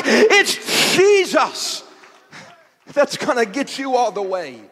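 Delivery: loud, rising preached contrast; preaching at full force. Acted. Preacher stirring the congregation, full-force preaching. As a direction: build and hammer it home.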